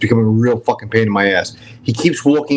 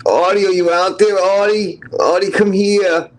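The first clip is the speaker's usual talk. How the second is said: calling out; 9 semitones higher than usual. Real, not acted. Calling out to Artie.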